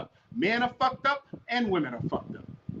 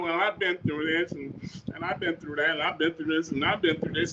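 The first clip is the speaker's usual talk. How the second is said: lower, softer mock of a self-pitying excuse; mocking excuse-maker; 6 semitones lower, 5 dB quieter than usual. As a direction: parody someone listing their hardships. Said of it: "'Cause it's all about, you know".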